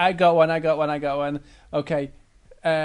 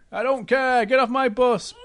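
raised-pitch, dismissive order; cross bus driver; 5 semitones higher, 4 dB louder than usual. Acted. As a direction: play an unhappy driver throwing them off. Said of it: "the bus driver wasn't very happy".

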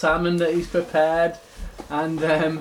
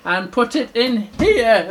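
higher, lightly voiced searching bear; a touch of playful uncertainty; louder storybook character voice; blindfolded little bear; 5 semitones higher, 5 dB louder than usual. Blindfolded bear gropes about.

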